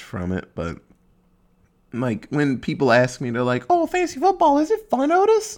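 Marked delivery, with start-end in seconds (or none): 3.7-5.6 much higher, chirpy questioning voice; mock curious asker. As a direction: play a nosy, chirpy friend asking. People asking him about fantasy football.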